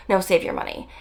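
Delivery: normal talking voice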